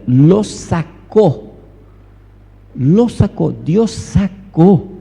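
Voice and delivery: "sacó" drawn out slowly and hit hard; emphatic repetition. Real, not acted. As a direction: hammer the word "sacó" for the congregation. Preacher hammers the verse's verb.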